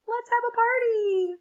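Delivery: high, loud cheer; excited party call. Acted. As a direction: shout it like a celebration. Crayon cheers.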